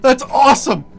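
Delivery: very high, loud amazement; thrilled. Real, not acted. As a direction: shout it in real excitement. Thrilled by new enchantment.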